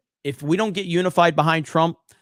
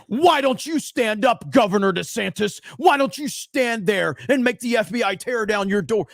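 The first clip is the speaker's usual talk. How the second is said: mocking quote; 6 semitones higher, 4 dB louder than usual.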